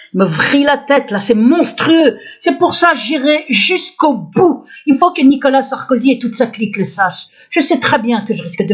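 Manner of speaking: real aggrieved defiance; indignant outburst. Real, not acted. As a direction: vow it with outrage, stretching key words. Denounces torture, vows to continue.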